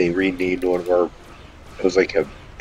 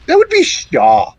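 high slow quote; 5 dB louder, slower than usual. Retold or imitated. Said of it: "I was like".

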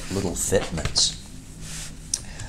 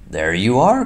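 louder, showing off the cart; proud cry; 4 semitones higher, 6 dB louder than usual. A narrator's reading. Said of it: "cried Toad".

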